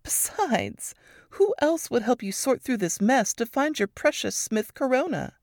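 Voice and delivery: lightly voiced wry teasing; Varys teasing. A narrator's reading. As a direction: grin through the words. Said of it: "With a crooked grin".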